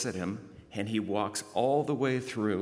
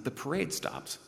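ordinary talk

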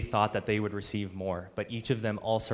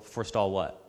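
normal talking voice

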